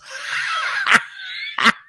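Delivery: high-pitched helpless laughter; squealing laugh, breaking up; real laughing fit. Real, not acted. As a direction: crack up at your own garbled reading. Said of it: "that's made me laugh quite a lot".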